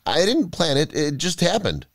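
mildly desperate protest; defensive cry. A narrator's reading. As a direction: plead innocence, caught out. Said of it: "he cried".

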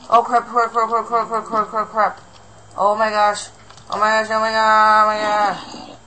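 high, loud rapid-fire panicked cursing; real fright, blurting it out. Real, not acted. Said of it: "That really scared me".

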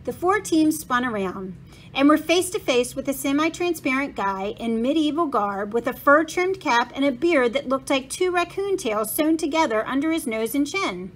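ordinary delivery, nothing marked

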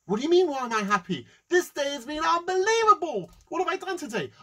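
high, loud overjoyed boasting; hammed-up elation. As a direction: burst with self-satisfied excitement. Rich man boasts of his day.

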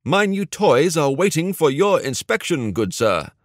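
high-pitched, hammed-up British butler act; mock British accent. A narrator's reading. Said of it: "spoke in a mock British accent".

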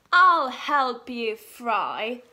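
loud, slow, hearty king voice; hungry king. Acted. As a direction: boom it out like a big kind king. Hungry king offers help.

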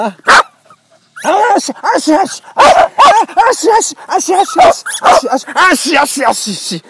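high howl mimicking the dog; howling dog imitation. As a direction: join the dog's howling, very high.

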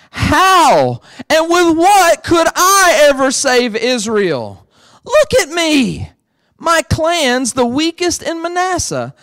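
high, slow, disbelieving Gideon; doubting Gideon. Retold or imitated. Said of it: "listen to Gideon's attitude".

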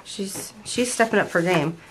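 ordinary delivery

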